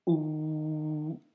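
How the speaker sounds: ou held long after a throat catch; block, then draw out the ou; long ou opened by a glottal stop. Shown as a demonstration. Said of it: "blocage avec un ou long".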